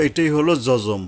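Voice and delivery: ordinary delivery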